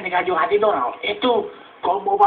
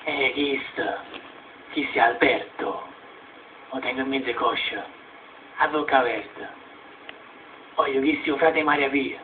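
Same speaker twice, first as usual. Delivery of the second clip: low muttering; 7 semitones lower, 9 dB quieter than usual. Acted.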